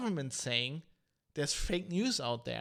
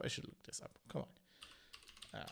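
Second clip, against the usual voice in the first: whispering; 3 semitones lower, 15 dB quieter than usual. Real, not acted. Muttering to himself while searching.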